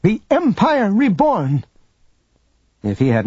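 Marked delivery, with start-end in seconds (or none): 0.0-1.6 high, drawn-out rallying cry; triumphant proclamation. A narrator's reading. Proctor declaring the Empire's return, high grand proclamation. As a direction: proclaim it grandly, pitched well up.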